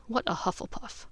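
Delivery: usual speaking voice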